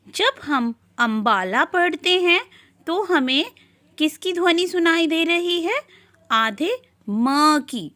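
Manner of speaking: normal talking voice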